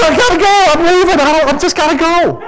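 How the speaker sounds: high-pitched retold desperation to get away; frantic insistence. Overwhelmed father telling wife he must leave, frantic. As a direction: plead your escape, at the end of your rope.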